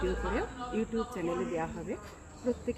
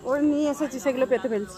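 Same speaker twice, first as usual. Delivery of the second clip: calling out; 4 semitones higher, 10 dB louder, faster than usual. Real, not acted. Calling out across the stage.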